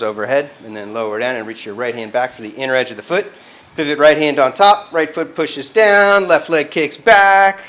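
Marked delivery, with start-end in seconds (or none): 3.8-7.6 loud, slow cues called across the room. Real, not acted. Teacher calling cues to the class, loud and slow. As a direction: project each cue over the class.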